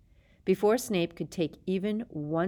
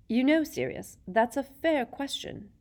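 Lupin voice; 8 semitones higher, 3 dB louder than usual. A narrator's reading.

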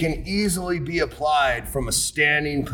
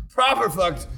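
mock British accent, said again; 5 semitones higher than usual. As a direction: echo "Proper fucked" with British flair. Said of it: "a proper King's English definition".

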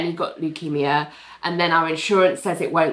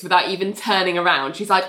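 normal talking voice

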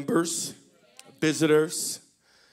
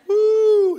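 high loud woo through laughter; laughing whoop; 10 dB louder than usual. Real, not acted. Pastor laughing at his own joke, high whoop. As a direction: whoop while cracking up.

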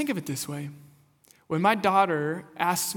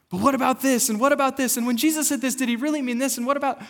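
higher, louder, tumbling questions; rapid questions; 7 semitones higher, 5 dB louder, much faster than usual. Retold or imitated. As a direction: pepper her with doubts. Doubter firing questions at wife.

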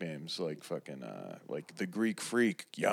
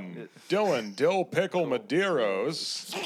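higher, swooping name announcement; mock baseball announcer; 4 semitones higher than usual. Announcing the friend by his nickname, swooping announcer voice. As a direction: roll the batter's name out grandly.

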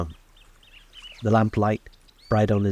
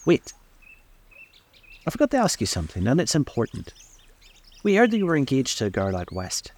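tempestuous; 5 semitones higher than usual. A narrator's reading. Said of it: "tempestuously called".

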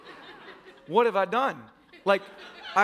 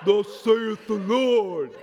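booming, drawn-out mock man voice; 5 dB louder, much slower than usual. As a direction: proclaim it slowly like a mighty prophet. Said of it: "give me, like, a man voice".

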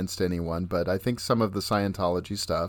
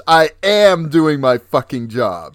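mock angry snap; 5 semitones higher, 9 dB louder than usual. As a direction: bark it back like a fed-up assistant. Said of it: "And she says".

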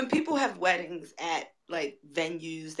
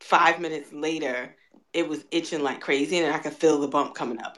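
usual speaking voice

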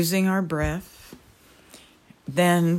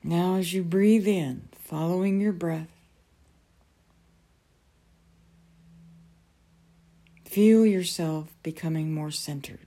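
guided meditation. Acted. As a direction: ease them gently into calm.

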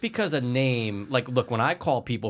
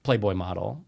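usual speaking voice

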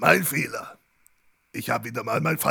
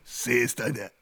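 pun delivery; 6 semitones lower, 5 dB quieter, much slower than usual. Acted. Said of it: "Man könnte fast sagen".

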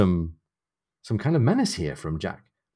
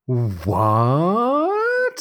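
high, drawn-out squeal of "what"; incredulous high yelp; shocked disbelief; 8 semitones higher, 3 dB louder than usual. Acted. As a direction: let the word soar up in mock shock. Host's mock shock at plot turn.

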